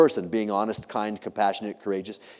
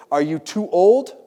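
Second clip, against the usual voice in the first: higher, louder, drawn-out question; disbelieving owner, voice raised; incredulous questioning; 6 semitones higher, 6 dB louder, slower than usual. Retold or imitated. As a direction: press them in slow disbelief. Owner questioning her dog in disbelief.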